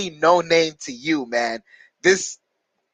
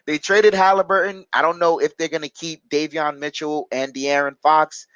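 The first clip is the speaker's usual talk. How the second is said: usual speaking voice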